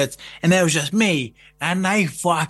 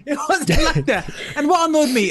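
laughing through the words; laughing protest; 8 semitones higher than usual. Real, not acted. Telling the co-hosts it wasn't like that, laughing. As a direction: giggle as you deny it.

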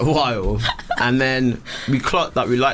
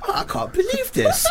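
much higher laughing voice; high squeaky quote. Retold or imitated. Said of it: "He's like".

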